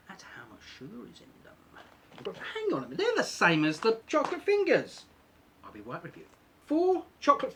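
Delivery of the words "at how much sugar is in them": fading to a near-silent whisper; trailing into whisper. Real, not acted. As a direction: let the voice drop away to a whisper. Voice drops away while checking the label.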